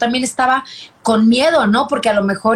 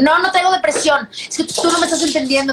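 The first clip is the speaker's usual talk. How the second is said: higher-pitched retold objection; defensive protest; 5 semitones higher than usual. Mother retelling her reply to Fer about depression, defensive protest. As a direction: push back as if misunderstood by your partner. Acts out arguing with partner.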